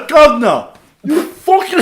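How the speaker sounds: loud, high fake Cockney yell; laughing Cockney impression shout; mock Cockney shout. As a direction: bellow it like a cartoon Londoner. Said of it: "It has to be governor".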